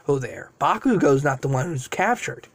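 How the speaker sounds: plain reading voice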